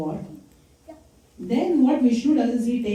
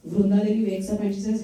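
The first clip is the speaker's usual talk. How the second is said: ordinary talk, nothing marked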